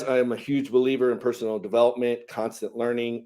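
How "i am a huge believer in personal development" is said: ordinary talk, nothing marked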